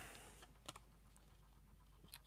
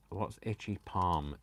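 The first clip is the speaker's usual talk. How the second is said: usual speaking voice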